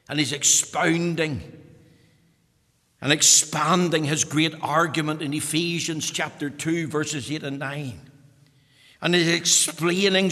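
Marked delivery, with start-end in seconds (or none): none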